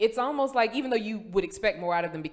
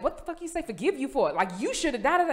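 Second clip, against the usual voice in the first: higher-pitched mock self-scolding; beating herself up; 8 semitones higher, 4 dB louder than usual. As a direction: tell yourself off in retelling. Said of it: "So I'm beating myself".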